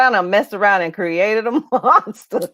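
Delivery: chuckling through a mock quote; laughing. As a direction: laugh as you voice his surprise. Host laughingly voices guest's thought.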